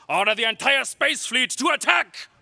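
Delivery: fast barked command; commanding order. Acted. Emperor ordering the whole fleet to attack, fast and commanding. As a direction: snap the order out at once.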